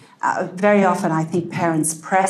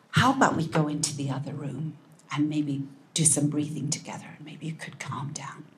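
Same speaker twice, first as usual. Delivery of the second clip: soft, calming voice fading to a whisper; 7 dB quieter than usual. Shown as a demonstration. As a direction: coax the child gently, almost whispering. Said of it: "and you use a soft voice".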